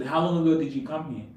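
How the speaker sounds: ordinary talk, nothing marked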